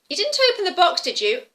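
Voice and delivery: loud, high sudden check; grandma sharply checking up on a child; suspicious grandma. Acted. Grandma questions the girl.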